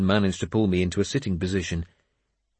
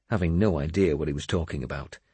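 plain reading voice

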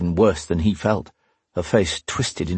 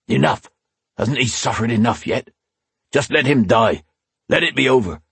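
protesting captain; 5 semitones higher than usual. A narrator's reading.

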